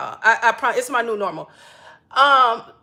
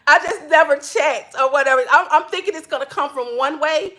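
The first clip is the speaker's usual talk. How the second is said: ordinary talk, nothing marked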